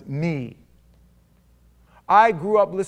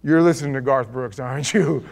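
ordinary talk, nothing marked

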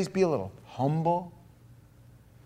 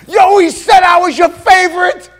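Fonzie crying out; 17 dB louder than usual. Retold or imitated. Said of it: "he looks at God and he goes".

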